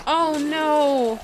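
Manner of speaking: ordinary delivery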